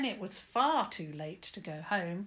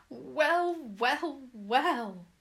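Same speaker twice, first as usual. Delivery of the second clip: put-on old granny voice; 7 semitones higher, 9 dB louder, much slower than usual.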